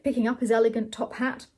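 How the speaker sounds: ordinary delivery, nothing marked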